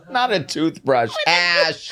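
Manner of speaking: high laughing outcry of disbelief; shocked disbelief. Real, not acted. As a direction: cry out in disbelief through a laugh. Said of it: "surprised outcry at toothbrush story".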